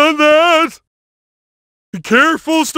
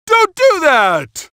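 louder, slowed cry of protest; hurt game hero crying out; pained protest; 6 semitones higher, 4 dB louder, slower than usual. Acted. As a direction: yelp it out. Game character hit.

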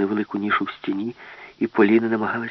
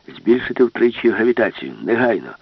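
louder, firm order; commanding demand; 2 semitones higher, 5 dB louder than usual. Acted. Professor orders the robot master, loud firm demand. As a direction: give the order firmly.

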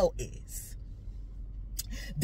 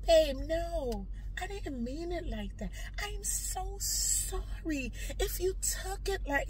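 soft, higher-pitched acted pleading; placating apology; 5 semitones higher, 8 dB quieter than usual. Wife soothing an angry partner, apologising softly. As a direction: apologise gently to calm them down.

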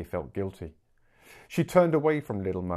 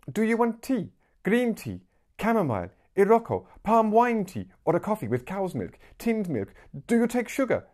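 high, bright-pitched seller's patter; eager little street seller; 12 semitones higher, 4 dB louder than usual. Acted. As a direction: rattle off the menu like an eager young hawker. Voices Little Mama.

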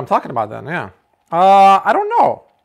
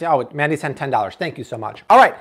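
ordinary talk

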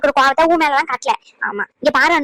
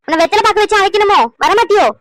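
higher, louder sarcastic rebuke; sarcastic scolding; 6 semitones higher, 4 dB louder than usual. Acted. Mother-in-law angrily summons daughter-in-law, loud sarcastic scolding. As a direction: snap at her for dawdling.

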